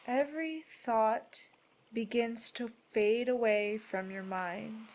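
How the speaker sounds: measured, soothing delivery, each phrase given room; guided meditation. Acted. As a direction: guide them gently into peace, unhurried.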